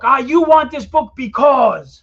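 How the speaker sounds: loud, high, slow fiery sales pitch. Shown as a demonstration. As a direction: pitch it with fire and passion. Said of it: "You gotta give us that, that fire".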